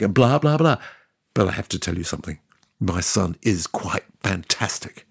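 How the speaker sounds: normal talking voice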